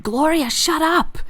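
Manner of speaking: high-pitched exasperated character voice; irritated Skek. Acted. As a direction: tell off a chattering flower. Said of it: "Skek looks up and he goes".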